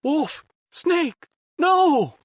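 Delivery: very high, loud panicked cry; desperate shout. A narrator's reading. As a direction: scream to stop them. Otacon screaming to stop.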